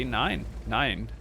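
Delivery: a quick jokey German "nein, nein"; mock German. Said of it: "Don't know why I'm becoming German baby".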